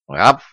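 short imitated little bark; friendly dog yap. A narrator's reading. Little grey dog inviting a guest inside, friendly yap. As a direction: give one welcoming yap. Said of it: "приветливо тявкнула она".

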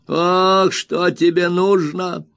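put-on high, slow, whining old miser's wail; spiteful whining old man. A narrator's reading. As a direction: whine it out spitefully like a crazed old junk dealer. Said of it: "спросил старик злобным, хнычущим голосом".

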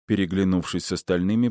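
ordinary delivery

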